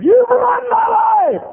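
very high, slow, loud wail; screamed mock accusation. Lady blaming the preacher for her misery, full scream. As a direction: stretch every word at a shriek.

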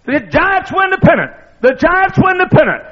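high, loud ecstatic radio call; announcer screaming the winning call. Retold or imitated. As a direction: scream it like the famous home-run call. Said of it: "he starts screaming".

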